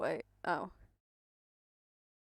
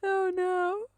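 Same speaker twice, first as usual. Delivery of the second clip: loud, high squeal of hilarity; helpless laughter; 11 semitones higher, 13 dB louder, slower than usual. Real, not acted. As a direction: drag the words out while cracking up. Helpless laughter at garbled captions.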